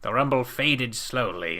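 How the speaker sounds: plain reading voice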